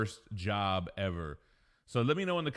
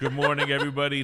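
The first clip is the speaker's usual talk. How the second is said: excited greeting; 3 semitones higher, 8 dB louder, faster than usual. Real, not acted. Restart of the greeting.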